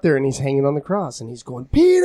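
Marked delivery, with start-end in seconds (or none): 1.6-2.0 calling out. Acted. Jesus calling from the cross.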